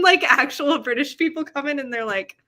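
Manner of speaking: usual speaking voice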